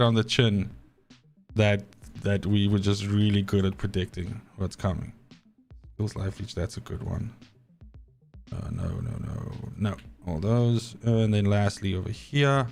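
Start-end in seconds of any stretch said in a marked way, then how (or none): none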